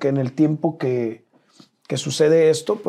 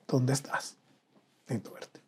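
much softer, almost fading away; subdued retold plea; 11 dB quieter than usual. Retold desperate phone call to wife. (súplica en voz muy baja, apagada)

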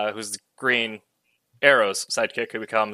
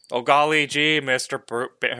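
eager, gee-whiz sidekick voice, raised and louder; wholesome 1950s kid; 3 semitones higher, 5 dB louder than usual. Retold or imitated. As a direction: play an earnest old-timey boy. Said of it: "they're not quite".

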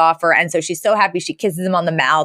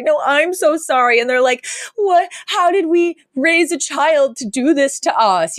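mock devastated parents; 8 semitones higher than usual. Voicing parents devastated in recap.

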